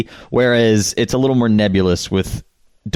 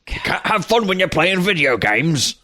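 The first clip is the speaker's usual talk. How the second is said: put-on higher, gravelly old sailor voice; grizzled seaman; 7 semitones higher than usual. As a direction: grumble it like an old sea dog. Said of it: "my impression of Matt's impression of a grizzled seaman".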